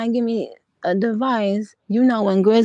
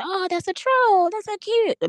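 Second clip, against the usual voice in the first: much higher, cooing mockery of gullible fans; mock cutesy fan; 12 semitones higher than usual. Mimicking fans who think the new troll is cute, high mock coo.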